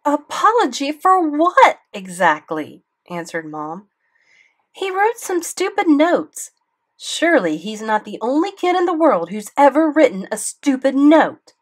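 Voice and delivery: mother scoffing at the apology letter, loud and high; scornful outrage. A narrator's reading. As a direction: sneer at the demand. Mom scoffs at apology demand.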